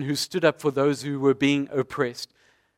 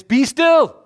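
much higher and louder command; Jesus ordering the wind and waves to stop; commanding call; 11 semitones higher, 8 dB louder than usual. Retold or imitated. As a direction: ring it out to calm the storm. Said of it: "And he says".

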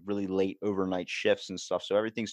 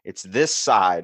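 mock-outraged outburst, slowing hard at the end; exasperated confusion; 7 dB louder than usual. Confused American driver protesting to the locals, loudly exasperated.

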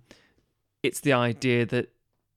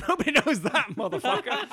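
high mocking voice; 11 semitones higher than usual. Mocking Spock, laughing.

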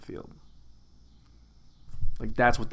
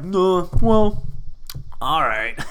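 louder hesitation, dropping into giving in; hammed-up grudging consent; mock reluctant acceptance; 3 semitones higher, 5 dB louder, much slower than usual. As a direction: play torn, then cave with a laugh. Acted reluctant giving in to offer.

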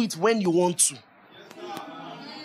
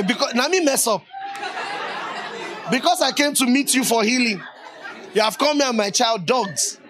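mock offended retort; 4 semitones higher than usual. Congregant answering Jesus after being called a dog, mock indignant. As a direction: play at being affronted and talk back. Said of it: "Respond as yourself".

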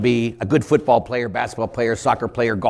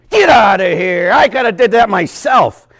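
high, loud exasperated shout; acted indignant dismissal; 8 semitones higher, 9 dB louder than usual. Player rejecting the useless coach, indignant shout. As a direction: throw the coach out at full voice.

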